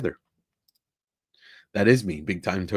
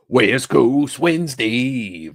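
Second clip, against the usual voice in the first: raised, drawn-out announcer call; loud show intro; 5 semitones higher, 6 dB louder, much slower than usual. Real, not acted. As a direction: call out the show name, stretching it. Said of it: "I can't be too loud".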